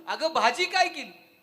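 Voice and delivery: slow, loud shout with a laugh. Retold or imitated. Husband shouts by his wife's ear, slow, loud and laughing. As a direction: bellow it right by her ear, laughing. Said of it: "कानाजवळ जाऊन जोरात ओरडला".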